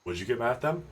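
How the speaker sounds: usual speaking voice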